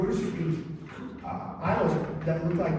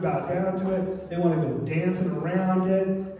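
usual speaking voice

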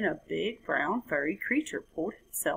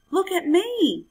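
much higher and louder, crowing; excited cry; 11 semitones higher, 7 dB louder than usual. A narrator's reading. The frog crowing to the jaguar from the tree. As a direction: shout it out high, showing off. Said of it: "he cried".

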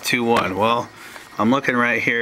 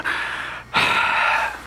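demo sigh. Shown as a demonstration. Teacher demonstrating the word sigh with a long sigh. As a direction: show how a sigh sounds. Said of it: "like when you go".

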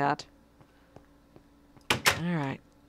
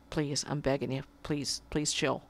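soft pleading; 4 semitones lower, 7 dB quieter than usual. Real, not acted. Streamer softly begging his cat to calm down, weary. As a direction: plead softly, almost giving up.

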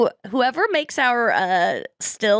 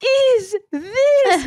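mock horror, drawn out and much higher; appalled disbelief; 12 semitones higher, 3 dB louder, much slower than usual. Woman retelling someone's reaction to the slutty outfit, hammed-up disbelief. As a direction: stretch each word in high, scandalized bewilderment. Retold shocked reaction to lingerie.

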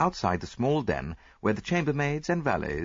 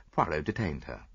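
ordinary delivery, nothing marked

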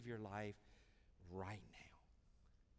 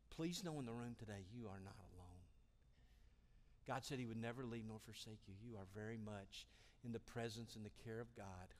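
reassuring; 3 semitones lower, 6 dB quieter than usual. Real, not acted. Preacher comforting the room, soft and tender. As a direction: comfort each listener.

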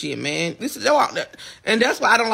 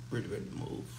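softer, murmured to himself; quiet murmur; 7 semitones lower, 13 dB quieter than usual. Real, not acted. Tired of the house, murmuring to himself quietly. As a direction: let it drop to a low mutter under the breath.

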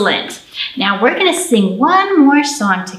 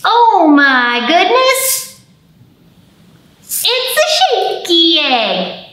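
high, slow, hammed-up amazement; mock surprise; 8 semitones higher, 3 dB louder, much slower than usual. Librarian acting amazed for children at the shaky egg. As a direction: gasp it out wide-eyed for the kids.

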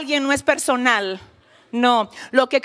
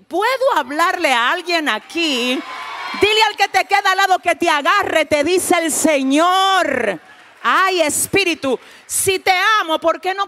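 preaching at full force; 5 semitones higher than usual. Acted. Preacher rousing the congregation to answer, shouting. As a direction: fire up the congregation.

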